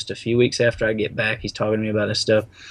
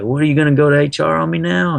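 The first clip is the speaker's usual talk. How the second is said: higher, fast sneering taunt; mocking taunt; 6 semitones higher, 4 dB louder, faster than usual. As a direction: needle him with a mocking question. Coworker mocking, retold.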